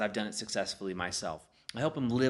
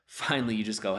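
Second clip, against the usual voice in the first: ordinary talk, nothing marked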